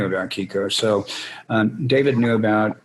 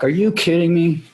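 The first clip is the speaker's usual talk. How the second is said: mock disbelief; 8 semitones higher, 3 dB louder than usual. His retold disbelief.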